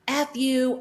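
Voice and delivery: sharp, loud, high yell at the guy; retold yell. Angry hairdresser yelling at the lawyer in her car. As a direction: spit it out like you're cursing him from the car. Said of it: "I'm, like, yelling at this guy".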